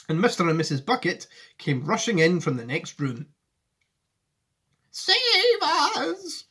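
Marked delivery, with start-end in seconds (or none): 4.9-6.4 high, mock frightened old lady; Grandma Josephine crying out in fright, high and mock; cried out. A narrator's reading. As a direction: wail for help, hammed-up. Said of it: "cried Grandma Josephine".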